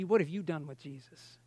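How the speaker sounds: low, soft, searching voice; grave, hushed question. Retold or imitated. Spirit of God speaking to the preacher's heart, hushed. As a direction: ask it very quietly and low. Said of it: "And he said to me".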